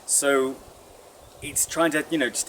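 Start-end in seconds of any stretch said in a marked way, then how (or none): none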